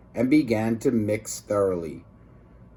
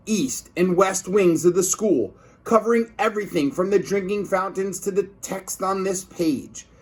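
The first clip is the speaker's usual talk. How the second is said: excited narration; 6 semitones higher than usual. A narrator's reading. Dramatic reading of goop wave.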